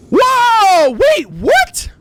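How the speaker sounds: high, loud startled whoa; startled shout. Real, not acted. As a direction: jump back in surprise. Startled by the surprise.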